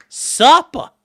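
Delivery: high, loud "Supper" in mock accent, final r dropped. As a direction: call out "Supper" as "suppa" in a put-on accent. Hammed-up repeat of the word.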